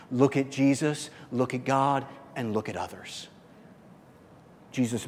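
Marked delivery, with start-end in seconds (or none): 0.1-3.3 softer, humble and calm; gentle. Retold or imitated. Preacher voicing the gentle one, softly and humbly. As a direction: say it gently, pointing away from yourself. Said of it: "The gentle one says basically this".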